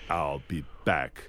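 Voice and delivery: low, slow robotic "I'll be back"; Terminator delivery. Shown as a demonstration. Star doing his famous line for kids, low, slow and robotic. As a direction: drop the pitch and say "I'll be back" like the machine. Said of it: "the way you say it in the movie".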